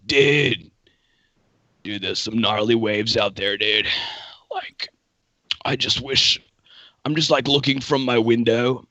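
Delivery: low, raspy American surfer dude; wistful surfer bro in an American accent, pitch sinking. Shown as a demonstration. Surfer longing for the waves outside his window, raspy American. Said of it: "more raspy for the surfer vibe".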